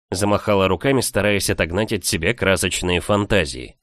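ordinary delivery

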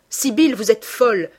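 forceful brotherly rebuke; exasperated scolding. A narrator's reading. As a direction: scold a foolish sister loudly. Brother scolds his infatuated sister.

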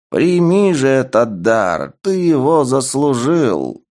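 slow, booming call to the arena; booming proclamation. Acted. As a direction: proclaim to the whole crowd. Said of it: "Голос архижреца ... разнёсся по арене".

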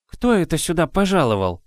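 put-on high anxious old woman; fretting nurse, alarmed; worried. A narrator's reading. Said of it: "заволновалась Банна". (взволнованно)

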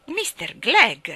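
slow, loud, stern aunt calling her husband; a starchy old wife reproving him; Mrs Glegg, stiff. Acted. Mrs Glegg rebukes her husband, stern and loud. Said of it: "disse missus Glegg".

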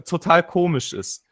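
ordinary talk

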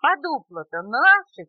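loud, high protest; high, loud squabbling cry; little animals shouting. A narrator's reading. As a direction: yell it like small creatures staking a claim. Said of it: "кричали зверьки".

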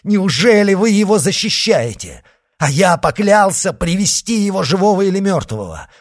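high, loud, desperate protest; wringing hands in dismay; anguished outcry. Acted. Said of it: "ломая себе руки".

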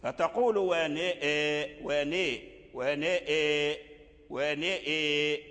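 نئ in ونئ drawn out with its vowel leaned toward i; imala demo. Shown as a demonstration. Teacher demonstrating imala on ونئ for students. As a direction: stretch ونئ slowly with imala. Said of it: "إذن فأميل النون لأجل الإمالة".

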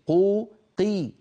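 قو and قي high, slow, swollen toward o; overemphasized qi. Shown as a demonstration. A teacher mocking the overdone قي. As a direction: stretch قي until it sounds like قو. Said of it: "وليس".

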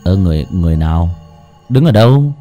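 bewildered. Acted. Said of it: "Kha ngơ ngác".

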